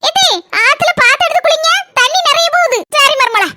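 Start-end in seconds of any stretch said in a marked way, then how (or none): none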